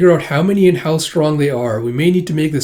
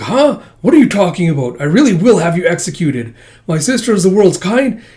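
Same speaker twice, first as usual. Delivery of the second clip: higher, louder offended protest; indignant outburst; 5 semitones higher, 5 dB louder than usual. Acted. Brother snaps back at someone who insults his sister. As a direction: defend your sister, outraged.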